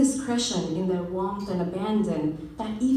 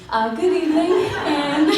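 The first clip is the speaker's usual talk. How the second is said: louder, higher, correcting herself; loud corrected greeting; 9 semitones higher, 10 dB louder than usual. Shown as a demonstration. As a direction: restart the greeting brightly, with a laugh in it. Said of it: "Gosh, I said afternoon".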